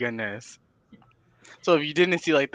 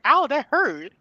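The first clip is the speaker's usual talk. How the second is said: mock whiny client; 13 semitones higher, 5 dB louder, slower than usual.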